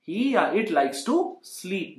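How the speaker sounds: very slow, spaced out for learners; teacher saying the line for students to copy, very slow; slow model sentence. Shown as a demonstration.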